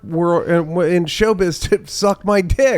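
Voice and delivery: high-pitched, indignant comic protest; mock protest. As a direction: protest it high, hammed-up like a wronged man. Acted protest of himself after a disaster.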